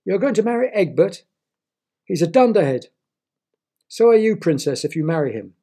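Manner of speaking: high, loud sneering outrage; scornful outburst. A narrator's reading. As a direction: spit out the insult. Suitor insults the princess.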